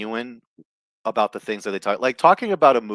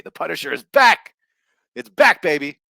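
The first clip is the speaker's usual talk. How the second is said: hyped announcement; 7 semitones higher, 8 dB louder than usual. Real, not acted. Hyping movie news.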